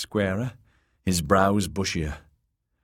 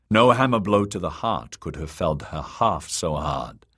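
plain reading voice

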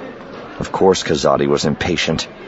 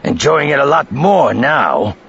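slow growling thug, pitched up and pushed; menacing, slow growl with raised pitch; 9 semitones higher, 4 dB louder than usual. A narrator's reading. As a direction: growl it at your captive, drawn out. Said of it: "Krovendiff growled".

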